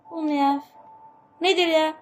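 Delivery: ordinary talk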